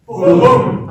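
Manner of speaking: big high shout of boom. Shown as a demonstration. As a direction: bellow it out to lead the room. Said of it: "shout boom as loud as you can".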